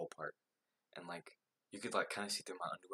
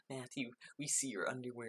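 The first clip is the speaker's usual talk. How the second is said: put-on higher teasing girl voice; imitating the girls; 6 semitones higher than usual. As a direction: mimic the girls giggling at him. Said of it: "And then they were like".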